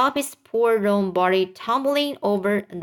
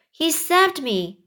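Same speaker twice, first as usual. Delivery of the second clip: higher, slow, lightly amazed; gasped exclamation; 7 semitones higher than usual. Acted. Rescued centipede telling the others, mildly gasped. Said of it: "gasped the centipede".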